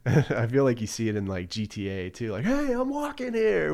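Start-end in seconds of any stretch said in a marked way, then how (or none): 2.5-3.7 mock New York yell. Quoting GTA pedestrians.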